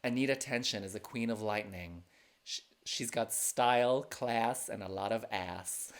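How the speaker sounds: a mock American announcer read; American accent. Shown as a demonstration. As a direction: read it like an American host would. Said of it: "Here's my American accent".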